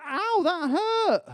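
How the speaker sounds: high, slow squeaky mock Drago. Preacher mocks Drago's pain, high and slow. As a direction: squeal it high like a wounded giant. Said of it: "It wasn't quite like that. It was more masculine".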